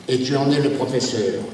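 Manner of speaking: ordinary delivery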